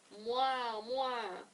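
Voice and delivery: water buffalo call. Retold or imitated. Reader voicing the old water buffalo, animal noise.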